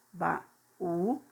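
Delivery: baú said slowly as two syllables ba-ú; split into syllables. Shown as a demonstration. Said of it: "duas sílabas".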